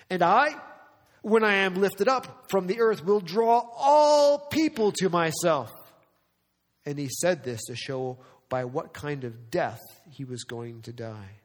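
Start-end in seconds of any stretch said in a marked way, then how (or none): 0.1-5.7 preaching at full force. Acted. Preaching climax of Jesus' words.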